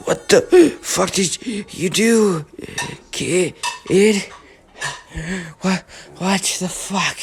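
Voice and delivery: slow, broken words between gasps; gasping back to life. Acted. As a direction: heave for air after being revived. Muscular revived from death.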